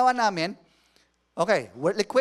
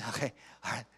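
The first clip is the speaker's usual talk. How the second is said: out of breath; 7 semitones lower, 9 dB quieter, faster than usual. Retold or imitated.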